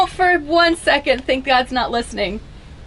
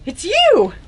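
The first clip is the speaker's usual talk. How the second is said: sudden gasp, then higher-pitched amazed cry; gasp of recognition; 4 semitones higher than usual. Acted. Mary instantly recognizing Jesus, gasping in amazement. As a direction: gasp and recognize a loved one. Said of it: "instantly she's like".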